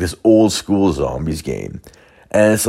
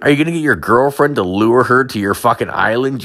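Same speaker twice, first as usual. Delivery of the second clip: higher, sneering jab; mocking accusation; 6 semitones higher than usual. Accusing the buddy.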